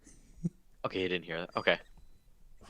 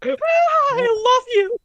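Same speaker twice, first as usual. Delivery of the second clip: very high, loud, drawn-out declaration; gasp, then squeal it slowly in a mouse voice; squeaky cartoon character; 5 dB louder, much slower than usual. Lovestruck character interrupts the podcast, squeaky cartoon voice.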